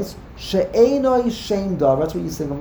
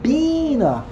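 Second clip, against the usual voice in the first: "bina" with BI pitched high, then falling; a high, strong BI in "bina", dropping on na; stress on first syllable; 8 semitones higher than usual. Shown as a demonstration. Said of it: "Then it is pronounced mila'el".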